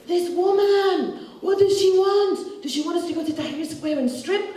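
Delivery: high, drawn-out indignant mock rant; ranting TV host. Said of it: "he just had this rant about me".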